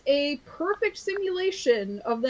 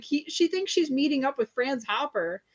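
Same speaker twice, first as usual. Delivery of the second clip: ordinary talk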